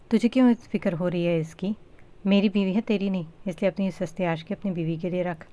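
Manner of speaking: ordinary delivery, nothing marked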